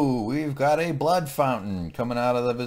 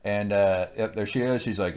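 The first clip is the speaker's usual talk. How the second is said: usual speaking voice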